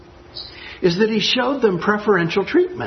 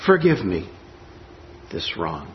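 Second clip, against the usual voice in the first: Forgive me this wrong, ending much lower and softer; sarcastic twist; 11 semitones lower, 4 dB quieter than usual. Shown as a demonstration. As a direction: let "wrong" sink away, quietly ironic. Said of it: "he gives the hilt another little twist".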